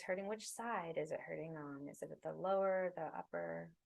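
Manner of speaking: unhurried, soothing breathwork guidance; guided meditation. Acted. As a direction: guide them gently into the body and breath.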